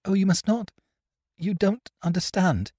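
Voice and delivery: put-on high, upset young woman; lightly voiced girl near tears, pleading; a young woman pleading with the prisoners, voice breaking. A narrator's reading. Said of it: "There was a break in her voice".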